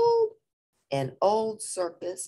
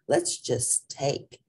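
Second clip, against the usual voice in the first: slow, drawn-out suggestion; slow scheming suggestion to his friend; suggesting; much slower than usual. Acted. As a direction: stretch it out, sly and slow. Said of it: "suggested Poquito Tito".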